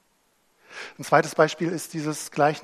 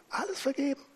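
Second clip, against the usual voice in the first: forgiveness; 12 semitones higher, faster than usual. Acted. Climax of forgiveness.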